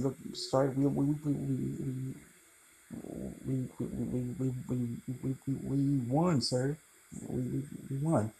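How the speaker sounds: fake-weep the words out brokenly, falling quiet; stammering Peter Parker, overplayed tearful whisper-soft; theatrical crying. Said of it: "I just have to do that for the theatrics".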